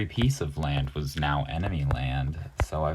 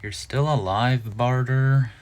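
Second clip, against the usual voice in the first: louder, higher gloating call; mock taunt; 7 semitones higher, 8 dB louder than usual. Gamer taunting enemy unit Barter, loud high gloat. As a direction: crow at the enemy that won't die.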